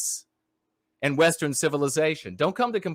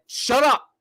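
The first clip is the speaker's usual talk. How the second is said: a sharp "Shut up" that is much higher and louder; snapped order; 10 semitones higher, 4 dB louder than usual. Acted. Imagined wife silencing her husband with a loud, high snap. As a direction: bark it down at him. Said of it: "she said".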